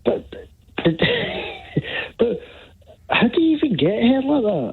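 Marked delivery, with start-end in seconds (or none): 0.8-2.1 high-pitched squealing laugh. Real, not acted. Laughing about the hair.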